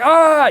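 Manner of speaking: a hammed-up tough-guy cry; mock thug yell. Imitating how a badass thug should yell, mock yell.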